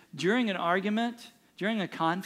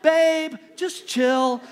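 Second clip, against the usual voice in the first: higher, louder, mock-patronizing babe; condescending chill; 8 semitones higher, 9 dB louder, slower than usual. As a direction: talk down to her sweetly. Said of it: "It's like".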